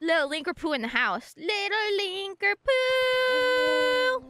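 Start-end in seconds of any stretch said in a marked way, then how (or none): 1.4-4.2 drawn-out high announce. Pretend. Announcing character.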